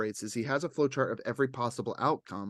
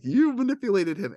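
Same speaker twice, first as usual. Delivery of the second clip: raised, louder retold accusation; mock accusation; 7 semitones higher, 6 dB louder than usual. Quoting the girl on the show confronting him, mock accusing.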